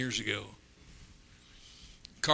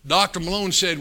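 normal talking voice

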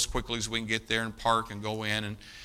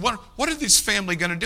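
fast, much higher and louder; frantic anguished question; distraught; 9 semitones higher, 7 dB louder, faster than usual. Retold or imitated. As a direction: blurt it out in panic. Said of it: "he's just distraught".

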